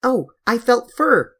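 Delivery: thrilled gasp of discovery; half laughing, half crying. A narrator's reading. Girl telling the others she felt fur, high and excited. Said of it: "she cried, half laughing and half crying".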